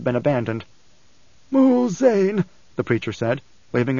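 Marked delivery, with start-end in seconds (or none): 1.5-2.5 put-on high, strained old preacher's voice. Preacher to Leto with a cutting gesture, high and emphatic. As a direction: raise the voice well above narration for the preacher.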